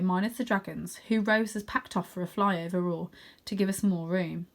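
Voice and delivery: usual speaking voice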